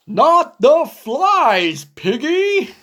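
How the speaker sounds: slow, stressing not; dismayed protest. Acted. Gerald objecting that the flies are not it. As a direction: lean hard on not.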